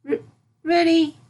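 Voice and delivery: high, stammered and pushed out; nervous stammer. A narrator's reading. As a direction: force the word out nervously. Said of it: "Sunset turned nervously".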